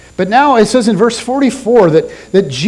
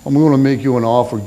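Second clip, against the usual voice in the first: a quick, lightly voiced second go at the Godfather; retry the Brando offer, faster and barely in character; Marlon Brando retry; 5 semitones lower, faster than usual. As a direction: rush a half-hearted mob-boss line. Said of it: "The Marlon Brando thing".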